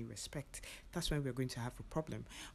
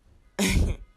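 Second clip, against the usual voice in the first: sudden loud laugh, pitched up; loud burst of laughter; 7 semitones higher, 13 dB louder than usual. Real, not acted. Amused at the married stranger, loud laugh.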